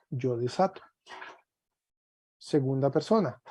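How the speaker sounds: usual speaking voice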